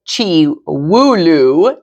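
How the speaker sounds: chiwooloo drawn out slowly as chee-woo-loo; child mispronunciation. A narrator's reading. Jujubee mangles chihuahua, slow and drawn out.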